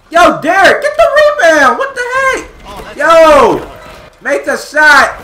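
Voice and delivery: high, loud yelling at a teammate; frustrated shout. Real, not acted. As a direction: yell the orders in desperation.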